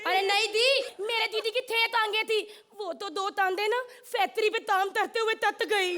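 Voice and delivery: k turned to t, as in "तत गई"; childlike lisp. Acted. Lisping daughter correcting about sister, "तरते" with t for k. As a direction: lisp it, "ताम" for काम. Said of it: "एक तोतली है".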